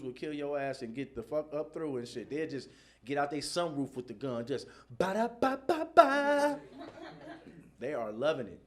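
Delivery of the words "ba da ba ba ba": high, slow rattling gunfire sound; mimicked gunfire. Kids firing from a sunroof, mimicked gunfire. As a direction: spit out the shots like an automatic.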